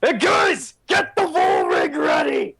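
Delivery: hollered call to the crew; high yelp, then a slow rallying shout; yelling. Acted. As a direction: shout orders to guys across the yard. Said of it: "you hear him yell".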